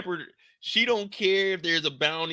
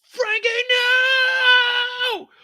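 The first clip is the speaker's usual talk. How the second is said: high, loud, drawn-out scream; anguished scream; 7 dB louder, much slower than usual. Retold or imitated. Crew watching Frankie get shot, long anguished scream. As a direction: cry out as your friend falls.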